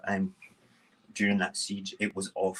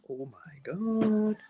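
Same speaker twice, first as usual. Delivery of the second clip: slow, higher, drawn-out exclamation; retold disbelief; 10 semitones higher, much slower than usual. Neighbour reacts to sudden mood switch, drawn-out disbelief. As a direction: stretch it out in mock disbelief.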